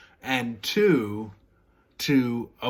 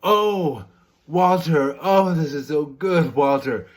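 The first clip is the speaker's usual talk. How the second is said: loud, raised mock moaning praise; hammed-up appreciative cries; acted pleasure; 4 semitones higher, 6 dB louder than usual. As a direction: gush a partner's name in pretend delight. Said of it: "instead of saying".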